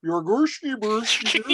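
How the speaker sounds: slow, high Swedish gibberish; mock Swedish accent. Shown as a demonstration. Player showing how Swedish would sound, mock Swedish accent. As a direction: exaggerate a sing-song Swedish accent. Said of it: "If he was doing Swedish".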